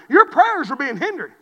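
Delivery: loud, firm sermon line; emphatic preaching. Acted. Preacher warning couples about prayer, firmly. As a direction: state it with weight.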